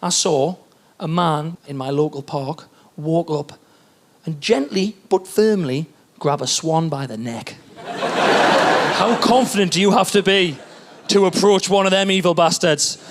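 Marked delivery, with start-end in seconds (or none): none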